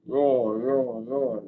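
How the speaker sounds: loud playful dinosaur roar. Pretend. Child reading a dinosaur book aloud, loud playful roar.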